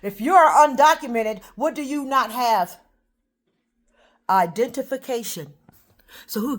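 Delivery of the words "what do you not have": ordinary talk, nothing marked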